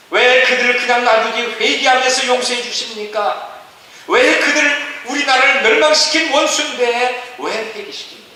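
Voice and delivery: high, insistent protest to God; hammed-up indignant grievance, pressing each question; resentful complaining. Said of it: "불평하기 시작하죠". (따지듯 원망하는 높은 목소리)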